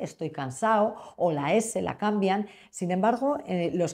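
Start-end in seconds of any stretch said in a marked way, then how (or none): 0.0-0.9 "cansado" sin D, alargando el -ao final; D omitida. Shown as a demonstration. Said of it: "omite la D". ("cansado" a la andaluza, sin la de)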